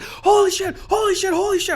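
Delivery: high-pitched, breathless-style celebration; retold giddy excitement. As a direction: relive the thrill of winning. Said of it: "I was so excited".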